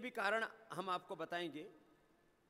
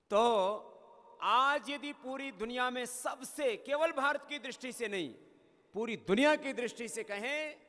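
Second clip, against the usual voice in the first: impassioned sermon; 3 semitones higher, 6 dB louder than usual. Acted. Declaims the core message. (धीमी, ऊँची और ज़ोरदार उद्घोषणा)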